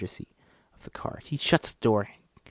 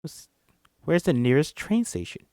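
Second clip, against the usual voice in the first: usual speaking voice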